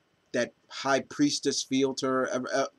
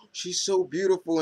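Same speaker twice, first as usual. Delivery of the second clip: mock gasp, then higher-pitched gushing; fluttered admiration; 6 semitones higher than usual. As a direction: lightly imitate a smitten, fluttered girl. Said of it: "Usagi is just fluttered with".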